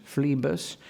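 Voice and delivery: PHLEBAS said as "FLEE-bus"; long ee in phlebas; Eliot's pronunciation. Shown as a demonstration. Lecturer repeats the preferred name, phlebas as flee-bus. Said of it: "not phlebas, for instance".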